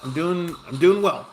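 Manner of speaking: normal talking voice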